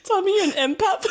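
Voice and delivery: high, loud, squeezed through laughter; laughing near tears; breaking up laughing. Real, not acted. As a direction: barely get it out between laughs. Friends crying with laughter.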